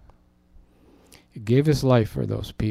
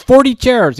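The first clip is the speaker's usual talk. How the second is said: loud, high proud announcement; emphatic shout; 11 semitones higher, 11 dB louder than usual. Retold or imitated. Caller proudly offering chairs, loud. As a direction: belt out the number. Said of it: "He says".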